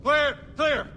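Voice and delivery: high, loud one-word shouts; urgent call-out. Acted. Police calling the room clear, urgent shout. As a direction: call it sharply across the room.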